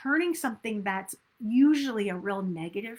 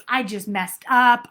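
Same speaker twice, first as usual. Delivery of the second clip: louder, annoyed outburst at yourself; frustrated self-talk; 7 dB louder, slower than usual. Retold or imitated. Coach imitates negative self-talk after a slip, louder and annoyed. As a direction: blurt out the mistake in frustration. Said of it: "moving into negativity of".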